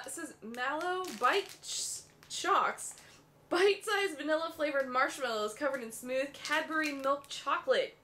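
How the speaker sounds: usual speaking voice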